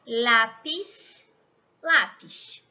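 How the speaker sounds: lápiz said slowly in Spanish, with a clear final s; Spanish lápiz, said as a teaching model. Shown as a demonstration. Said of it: "Por ejemplo".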